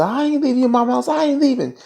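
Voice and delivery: very high falsetto whine; mock whiny refusal. Refusing Jacob's order to get out, mock whine. Acted reply in the quarrel.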